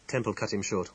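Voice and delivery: ordinary delivery, nothing marked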